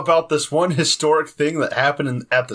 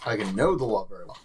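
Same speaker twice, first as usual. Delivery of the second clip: lower, softer aside; lowered-voice repeat; 5 semitones lower, 6 dB quieter than usual. Acted. Player repeats his claim about knowing the law, lowered voice. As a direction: repeat it low and under the voice.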